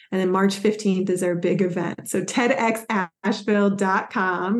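ordinary delivery

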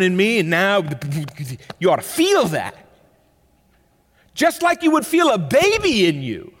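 loud, high, driving sermon voice; rising passion of a preacher at the pulpit; full-force preaching. Acted. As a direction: preach it out to the whole room.

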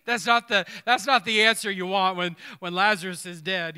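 loud, punchy exclamation; rising to full volume in the pulpit; emphatic preaching. Real, not acted.

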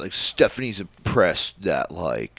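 slow, poor wrestler impression; Baron Corbin. Constable relaying Stephanie's approval, slow mock impression. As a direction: draw the words out in a badly faked character voice. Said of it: "Corbin sounds nothing like this".

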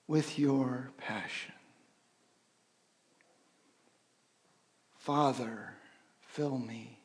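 slow, soft prayer with breath cues; hushed meditative prayer; guided breath prayer. Acted. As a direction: guide them through the breathing, softly.

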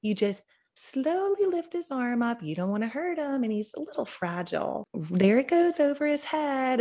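a touch of the tender midwife, lifting a fragile newborn's arm; imitating a soothing midwife explaining how to dress a baby; gentle midwife voice. Putting on this onesie so delicately.

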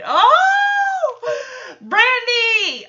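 high, loud squeal of delight; real high-pitched excited shout; excited cry. Real, not acted. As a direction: shout it out high, thrilled to see the dog. Host thrilled by dog's entrance.

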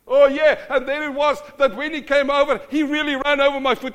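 escalating loud retelling voice; loud matching voice. Acted. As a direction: get as loud as the upset person. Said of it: "We match".

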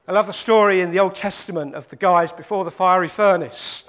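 usual speaking voice

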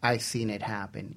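normal talking voice

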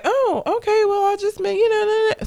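high-pitched imitation of her backing down; mock her going along too easily, voice up high; mock meek acceptance. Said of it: "She was way too just like".

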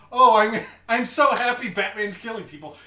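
gleeful Joker. Retold or imitated. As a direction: delight in the chaos, Joker-style. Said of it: "Joker's response is".

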